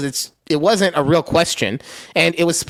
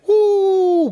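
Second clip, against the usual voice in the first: long high "woo"; playful high whoop, held out; mock falling whoop. Joking about a Vegas jumper, high mock whoop.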